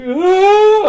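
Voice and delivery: sudden high squeaky cry; baby's high squeal. Retold or imitated. Mimicking the baby meeting Jessica, high squeal.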